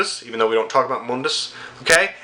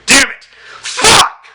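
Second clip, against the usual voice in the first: very loud, high shout; frustrated outburst; 11 semitones higher, 8 dB louder, slower than usual. Real, not acted. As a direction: explode with rage.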